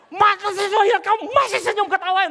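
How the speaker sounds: very loud, high furious shouting; imitated full-blown angry tirade; angry scolding. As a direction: play an enraged customer yelling. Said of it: "saya dimarahin itu".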